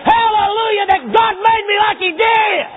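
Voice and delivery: high, loud joyful shout; shouting hallelujah. Retold or imitated. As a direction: shout it out in praise. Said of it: "take off shouting and saying".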